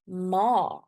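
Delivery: "ma" with a long, drawn-out A; long vowel. Shown as a demonstration. Teacher introduces the Afrikaans word for mom, "ma" held long. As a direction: stretch the A in "ma". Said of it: "the sound of the A is very long".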